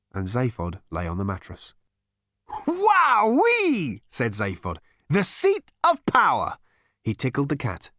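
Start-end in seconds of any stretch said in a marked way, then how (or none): 2.7-4.0 very high, loud cry of delight; over-the-top excited exclamation; gleeful whoop. A narrator's reading. Zaphod delighted by the shack. 5.1-6.6 high, drawn-out triumphant crowing; gleeful grandiose proclamation; mock-grand delight. A narrator's reading. Zaphod lounging on the ruler's mattress.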